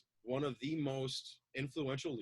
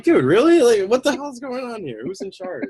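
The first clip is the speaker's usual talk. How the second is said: loud, high exasperated disbelief; mock outrage at dog; 8 semitones higher, 21 dB louder than usual. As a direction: scold the dog, hammed-up. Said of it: "I'm like".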